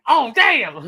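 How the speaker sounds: high, loud mock alarm; hammed-up startled holler; shocked yell. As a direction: cry out in comic shock. Acting out reaction in comedy bit.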